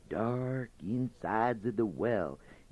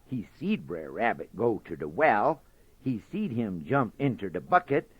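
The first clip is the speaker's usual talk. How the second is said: plain reading voice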